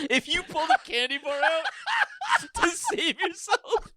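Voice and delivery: high-pitched, helpless laughing speech; laughing hard. Real, not acted. Riffing on the candy bar joke, laughing hard. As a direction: squeeze the words out between laughs. Friends cracking up over the joke.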